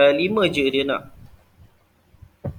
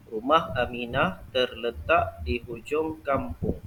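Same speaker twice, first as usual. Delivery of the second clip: slow, softer repeat of the model reading with a falling statement tune; careful reading with intonation; 2 semitones lower, 5 dB quieter than usual. Shown as a demonstration. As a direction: say it once more, slowly and softly. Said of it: "ni Ustaz akan bacakan". (ulang bacaan contoh dengan intonasi ayat penyata)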